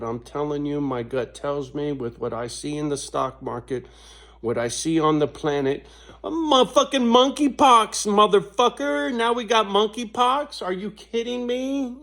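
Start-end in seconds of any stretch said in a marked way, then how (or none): 6.2-12.0 pitched way up and louder, can't believe it; exasperated disbelief, voice jumping high; incredulous outburst. Real, not acted. Venting to viewers about monkeypox, incredulous and high.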